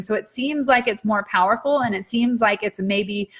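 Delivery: usual speaking voice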